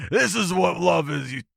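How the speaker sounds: high, hammed-up outburst; mock angry dad. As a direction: rant as an outraged monster father. Playing dad in the family quarrel.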